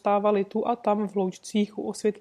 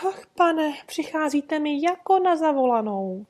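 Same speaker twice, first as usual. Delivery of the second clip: high, louder relieved outburst; eager exclaiming; 6 semitones higher, 3 dB louder than usual. Acted. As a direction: cry out with sudden hope. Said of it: "zvolal mužíček".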